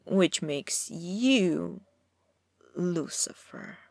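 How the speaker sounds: slow, drawn-out venomous hiss of the name; slow venom, savoring the insult. A narrator's reading. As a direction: spit the name out slowly like poison. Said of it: "hissed the name with such venom".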